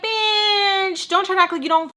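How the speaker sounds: high-pitched, retold angry outburst; mad. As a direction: lash out in mock fury, high. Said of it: "now I'm mad".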